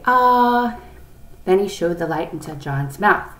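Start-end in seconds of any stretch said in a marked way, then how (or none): 0.1-0.8 long, high, loud "aah". A narrator's reading. Boy at the doctor's checkup, sustained "aah". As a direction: hold an open-mouthed aah for the doctor. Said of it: "open wide and say".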